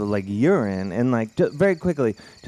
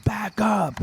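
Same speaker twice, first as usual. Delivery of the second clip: homeless guy yelling; 11 semitones higher than usual. Retold or imitated. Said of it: "again, he's like".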